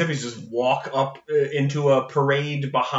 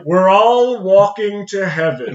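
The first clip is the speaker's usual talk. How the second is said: mock grand god voice; 6 semitones higher, 8 dB louder, slower than usual. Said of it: "and is like".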